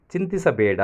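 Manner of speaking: ordinary delivery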